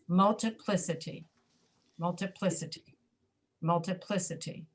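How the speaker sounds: "multiplicity" with stress on PLI, other vowels reduced; one accented syllable. Shown as a demonstration. Teacher testing which syllable is accented, "multiplicity" stressed on PLI. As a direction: say "multiplicity" as mul-tuh-PLIS-uh-tee. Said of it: "only one of them is accented".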